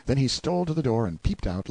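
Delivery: ordinary delivery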